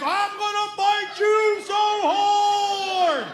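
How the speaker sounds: high, drawn-out charging yell; mock battle cry. Son bursts out of the bathroom at dad, long hammed-up yell. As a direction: bellow it slowly like a bull out of the chute. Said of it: "seen a bull come out of a chute".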